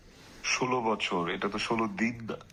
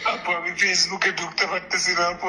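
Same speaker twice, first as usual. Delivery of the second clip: high, tearful and breathless; sobbing out the words in despair; crying on the phone; 8 semitones higher than usual. Real, not acted. Distraught on phone call. (ফোনে কাঁদতে কাঁদতে বলা)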